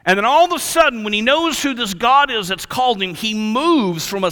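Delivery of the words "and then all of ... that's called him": ordinary delivery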